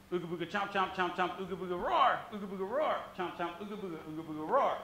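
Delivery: rapid-fire chant of calls; quick rhythm demo. Shown as a demonstration. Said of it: "That quick, all right?".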